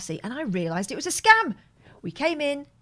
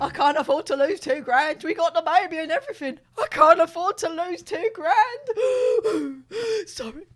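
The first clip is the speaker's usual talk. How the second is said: fake crying; 5 semitones higher, 5 dB louder than usual. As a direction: wail it like a fake sob. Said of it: "This is what he sounded like".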